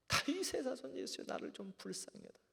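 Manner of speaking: high-pitched plea, easing off toward the end; crying out. Acted. Blind Bartimaeus begs Jesus for mercy, calling out. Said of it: "뭐라고 소리 질러요?".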